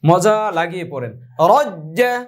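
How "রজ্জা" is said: রজ্জা with a lively high rise and a rolled র; read with zest. Shown as a demonstration. Teacher shows reading রজ্জা with joy and zest. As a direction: say রজ্জা with relish. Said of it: "একটু জোশ নিয়ে পড়েন".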